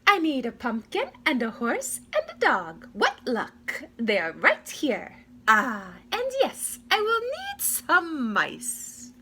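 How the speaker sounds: high fussy fairy voice; high, slow-stretched fairy godmother. A narrator's reading. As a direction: bustle about in a high little voice. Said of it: "said the fairy godmother".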